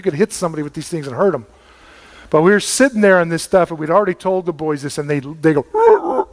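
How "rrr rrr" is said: high, rolling frog croak; a squeaky rolled-r frog noise; imitated frog croak. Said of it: "They make this really weird noise".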